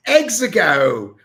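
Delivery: "Exego" said loud and high, no R; "Exego" as egg-zuh-go, proclaimed loudly; pronounced without the R. Shown as a demonstration. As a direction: announce "Exego" with the R dropped. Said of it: "apparently it's Exego".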